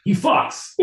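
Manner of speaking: breathless voiceless laugh through the words; can't hold the bit, laughing. Real, not acted. Breaks up laughing mid-bit.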